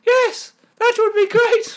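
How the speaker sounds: very high, loud crone squeak; a shrill old woman, hammed up to the rafters. GM voicing Gudrun agreeing with Bonak, in a piercing old crone falsetto. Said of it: "It's uncanny how good that was".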